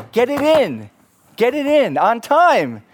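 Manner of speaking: high, forceful order; emphatic mock-stern command; stern warning. Professor's last warning to students.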